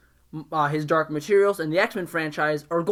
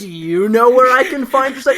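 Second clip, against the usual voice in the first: mock question; 6 semitones higher, 9 dB louder than usual. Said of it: "Claire Foy voiced".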